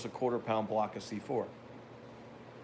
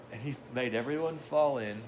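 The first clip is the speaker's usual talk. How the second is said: normal talking voice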